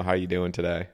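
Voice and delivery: usual speaking voice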